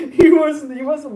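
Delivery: words bursting out in high laughter; laughing. Real, not acted. Telling friends about a tired kid, laughing. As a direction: laugh through the start of the line. Cracking up mid-story.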